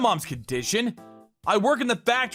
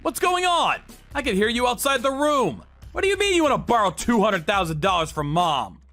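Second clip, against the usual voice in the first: raised, demanding questions; alarmed confrontation; 6 semitones higher than usual. Acted. Sibling overhears the loan request and walks in, alarmed. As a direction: demand to know what's happening.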